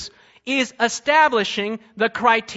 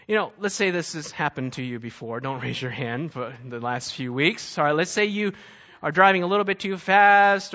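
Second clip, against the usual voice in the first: lower, softer conversational sermon voice; soft preaching; 7 semitones lower, 4 dB quieter than usual. Acted. Preacher setting up an example for the congregation, softer. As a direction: draw them in with a quiet example.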